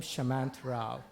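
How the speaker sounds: ordinary talk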